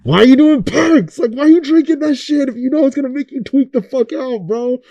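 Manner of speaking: high, incredulous; exasperated question. Retold or imitated. Asking drug users why, high and exasperated. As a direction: demand an answer in disbelief. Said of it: "looking at you guys like".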